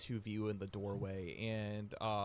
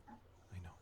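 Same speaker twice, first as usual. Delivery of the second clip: whispered and soft, almost breathless; 3 semitones lower, 14 dB quieter than usual. Acted. Oderic whispers softly to his weeping mother. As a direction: hush it gently to comfort her. Son reassures tearful mother.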